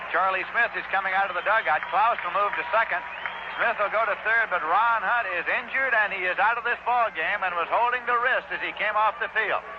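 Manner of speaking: ordinary talk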